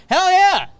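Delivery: loud, high cheer; excited. Real, not acted. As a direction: whoop it out. Cheering the weak outfield.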